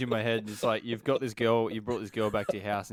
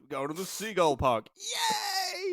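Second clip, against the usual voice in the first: mock excitement; 10 semitones higher, much slower than usual. Mock family outing to seagull park.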